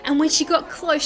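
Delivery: ordinary delivery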